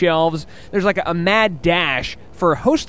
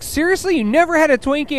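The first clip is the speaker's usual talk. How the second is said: incredulous; 10 semitones higher than usual. Retold or imitated. Wife's disbelief retold, "it was like.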